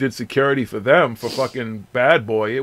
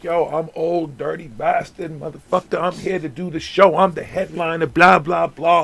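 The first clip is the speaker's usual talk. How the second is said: a hint of a brash rapper, pitched up; 5 semitones higher than usual. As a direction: play the pushy star in your face. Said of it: "this motherfucker is in my face like".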